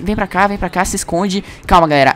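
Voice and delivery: normal talking voice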